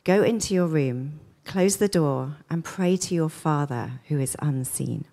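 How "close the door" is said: ordinary talk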